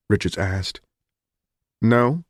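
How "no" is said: mildly curt, a little higher; brief, dismissive refusal; understated curtness. A narrator's reading. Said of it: "Donahue said curtly".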